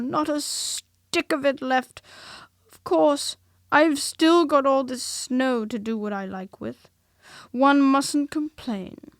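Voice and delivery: lightly voiced Eeyore, self-pitying; resigned donkey. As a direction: make the best of it like a put-upon donkey. Eeyore's held character voice.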